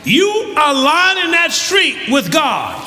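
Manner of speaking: high, impassioned pulpit shout; full-force preaching. Real, not acted.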